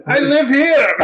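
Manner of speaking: high, hammed-up Albanian protest; put-on Albanian accent. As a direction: insist indignantly as a fake Albanian. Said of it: "I can't put this on".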